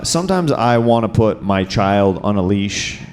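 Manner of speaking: ordinary delivery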